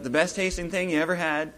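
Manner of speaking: normal talking voice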